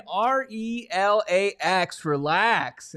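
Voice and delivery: slow, letter-by-letter spelling; imitated Rodgers spelling. Fans answering his panic with a quote, slow mock reassurance. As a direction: space out each letter, then land the word. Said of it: "giving me the Aaron Rodgers".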